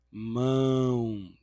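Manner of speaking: Mão, with the O heard as U; nasal demo. Shown as a demonstration. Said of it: "o O vai ter o som do U". (Mão com som de U nasal)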